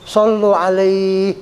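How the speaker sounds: "Sallu alaihi" with the lai held long; slow recitation, stretching the "ai" in "alaihi"; lengthened ending. Shown as a demonstration. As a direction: draw out the end of "Sallu alaihi" on a level note. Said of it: "boleh dipanjangin".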